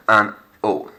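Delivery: an O with the H dropped; "an O", the H never sounded; silent H. Shown as a demonstration. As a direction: model "an O" loud for the class. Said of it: "Notice that the H is silent".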